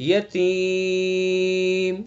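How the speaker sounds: یتیم with the vowel held long for four counts; four-alif elongation. Shown as a demonstration. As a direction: draw یتیم out four beats. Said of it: "چار الف ہو گئے".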